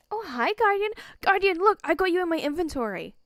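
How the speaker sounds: high and loud, delighted to see the creature; gleeful loud greeting, rushing the end; excited greeting. Real, not acted. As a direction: call out to the pet happily. Talking to in-game creature.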